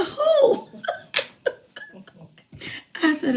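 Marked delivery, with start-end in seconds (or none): 0.0-0.8 high squeaky Who breaking into a laugh; mock the ex-husband's disbelief with a giggle; incredulous laugh. Quoting her ex-husband's startled reply, high and laughing.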